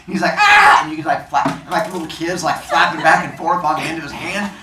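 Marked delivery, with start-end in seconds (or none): 0.4-0.8 high, loud yelp; mock pain yell. Rescuer bitten by the kid he grabs, high mock yell. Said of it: "the kid bites him".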